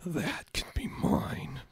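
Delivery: slow hushed whisper. Acted. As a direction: breathe it out slowly. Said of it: "his subconscious roared".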